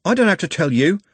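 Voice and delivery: high, stubborn retort; defiant. Acted. Trev refusing the constable, high defiance. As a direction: refuse sullenly.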